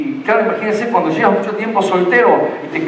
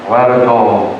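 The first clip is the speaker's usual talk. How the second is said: lower, louder booming call, as if echoing; mock echoing God voice; 8 semitones lower, 4 dB louder, slower than usual. Pastor mocking how people imagine God's voice, echoing call. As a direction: boom the name like a voice from the heavens. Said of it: "una voz con eco".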